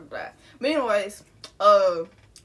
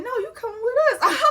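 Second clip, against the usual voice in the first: high, louder mock insistence; insistent retelling; 13 semitones higher, 6 dB louder than usual. Relative stopping the teller walking back to school, insistent. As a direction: insist in a much higher voice, half laughing.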